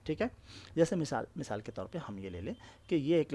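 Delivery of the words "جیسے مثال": usual speaking voice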